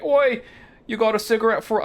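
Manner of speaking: put-on high, loud Cockney street kid; Kuno. Rude kid yelling at a passer-by, mock Cockney. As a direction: heckle like a squeaky Cockney child. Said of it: "Cockney Disco Elysium children".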